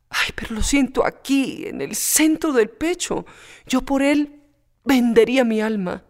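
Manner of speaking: every word torn from her chest; passionate confession. Acted. Pepita admitting she'd sell her soul, passionate.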